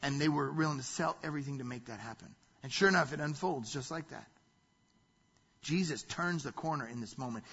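ordinary talk, nothing marked

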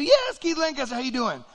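high, loud, exuberant confirmation; hearty caller. Acted. Famous singer answers a doubtful preacher, high hearty voice.

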